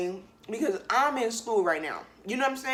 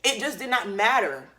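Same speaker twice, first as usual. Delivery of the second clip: ordinary talk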